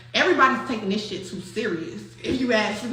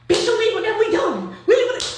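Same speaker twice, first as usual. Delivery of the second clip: high, loud imitation of the boyfriend yelling; a hammed-up boyfriend laying down the ultimatum; mock angry yell; 10 semitones higher, 5 dB louder than usual. Boyfriend yelling at his girl to leave with him, loud and high.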